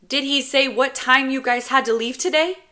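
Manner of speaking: put-on high, loud little boy voice; nagging child. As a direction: pester like an ignored kid. Child James nagging ignored brother.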